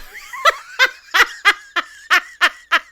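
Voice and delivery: high loud cackling laugh; cracking up at the crude joke; burst of laughter. Real, not acted. Laughing at her co-host's crude remark, a high loud burst.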